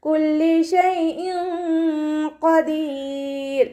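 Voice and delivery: slow, drawn-out melodic tajweed chant; Quran recitation. Shown as a demonstration. Teacher models melodic Quran recitation for students. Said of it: "ഖാഫ് ഇസ്തിഅലാൻ്റെ ലെറ്ററാണ്".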